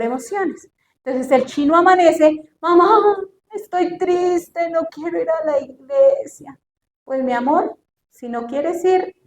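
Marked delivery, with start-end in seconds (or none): 2.6-6.6 put-on slow, droopy child's whimper; sulky sad child. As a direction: drag it out like a sulking kid. Niño que no quiere ir. (niño triste y lento, haciendo pucheros)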